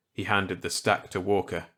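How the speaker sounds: ordinary delivery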